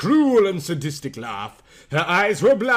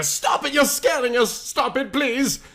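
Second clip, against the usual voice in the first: high, pleading cries; frightened plea; 8 semitones higher than usual. Acted. Yuki begs Raichu to stop feeding, high and scared. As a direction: beg her to stop, scared.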